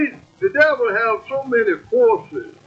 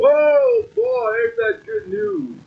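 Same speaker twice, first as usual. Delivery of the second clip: bursting out much higher, then the voice catches; joyful exclamation; 10 semitones higher, slower than usual. Real, not acted. Preacher moved to the congregation, rising high with a catch. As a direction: overcome with joy at the good news. Preacher exults over scripture.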